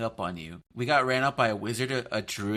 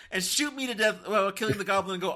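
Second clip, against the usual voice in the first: normal talking voice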